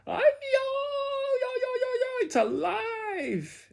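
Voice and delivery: very high yodelling shriek; comic scream. A narrator's reading. Lady finds the doll is alive, screaming. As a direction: babble in a high scream, then stretch the last word. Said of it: "The lady screamed".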